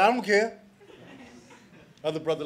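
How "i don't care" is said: high, loud, laughing bluff; fake bravado. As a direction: act tough while scared. Bluffing in front of boys.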